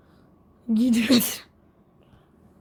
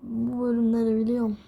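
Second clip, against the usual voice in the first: usual speaking voice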